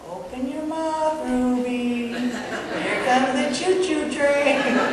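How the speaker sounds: drawn-out playful here-comes-the-train voice, laughing; sing-song baby feeding. Pretend. Parent coaxing baby Ruby to open her mouth, playful sing-song.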